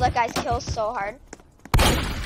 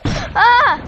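sudden yell; 12 semitones higher, 11 dB louder than usual. Real, not acted. Winning fight, sudden yell.

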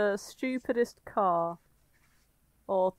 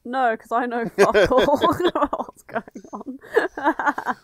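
laughing through the words, slow; giggling helplessly while admitting confusion; 4 dB louder, much slower than usual. Real, not acted. Host lost in the story, laughing as he speaks. Cracking up at the plot.

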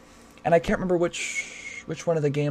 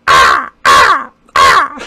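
foreign seagull call; 10 semitones lower, 14 dB louder, much slower than usual. Pretend. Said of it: "That's the seagull's, uh, foreign cousin".

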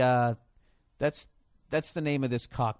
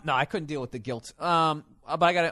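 ordinary delivery

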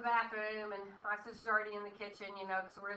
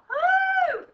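loud high whoop; excited cheer to herself; 14 dB louder than usual. Real, not acted. Cheering at her reflection.

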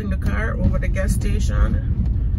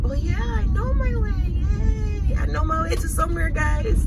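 high, excited squeal; 10 semitones higher than usual. Real, not acted. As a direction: celebrate like a small victory. Finally finds her way.

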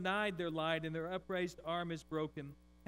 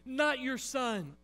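heavenly host begging God to spare his son; angels crying out; 8 semitones higher, 9 dB louder than usual. Acted. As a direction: beg at full voice, dragging the words.

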